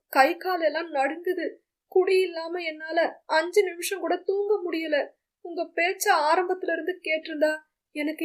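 a broken plea for forgiveness; desperate son. Acted.

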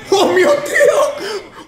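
very high, very slow, laughing; laughing astonishment. Real, not acted. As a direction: squeal it out in stunned delight. Amazed at own play. (stupore altissimo ridendo)

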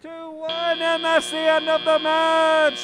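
loud announcer call, slow and stretched; final-buzzer call. Real, not acted. Announcer ending the match, a loud drawn-out call. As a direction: call time over the buzzer, drawing it out.

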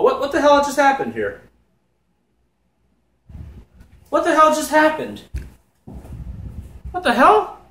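stunned disbelief. Real, not acted. Streamer reacting to the video's shock ending, stunned disbelief.

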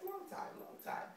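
high, hushed whispered confession; sheepish whisper. Retold or imitated. Admitting to hubby about the equipment, a high sheepish whisper. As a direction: whisper it like a guilty secret. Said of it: "I'm like".

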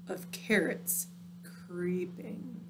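drawn out slowly, soft and ominous; slow and creepy. A narrator's reading. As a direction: let each word creep. Slow spooky narration.

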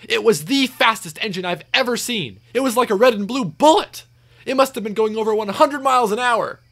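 high-pitched breathless-with-wonder account; James, excited. A narrator's reading. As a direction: gush in amazement, pitch way up. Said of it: "exclaimed James".

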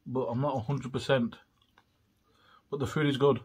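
eating between words; talking while chewing. Real, not acted. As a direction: mouth full of chips. Seen 2: Takes chips from box to mouth, chewing while talking throughout.

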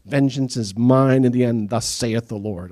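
slow, solemn scripture read; solemn King James. Pretend. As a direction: intone it like old scripture.

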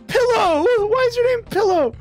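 high excited call; delighted shout at a funny name. Real, not acted. Calling out to player.

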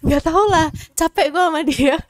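high, loud complaint; fed-up exasperation. Pretend. Player giving up on a partner, loudly exasperated. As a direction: throw up your hands, loudly fed up.

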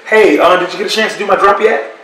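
quick, nervous, polite little request; a scared, careful ask of a feared man; timid polite ask. Retold or imitated. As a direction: ask meekly with a nervous laugh. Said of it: "That's how I really said it".

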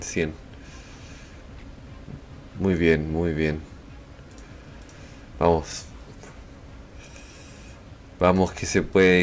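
usual speaking voice

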